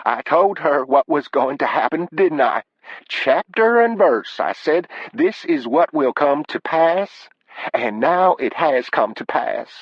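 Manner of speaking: high, slow told-you-so mother; high-pitched mother, righteous and emphatic; scolding mother. Acted. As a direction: pronounce doom in a high, drawn-out voice. Mother rails at caller.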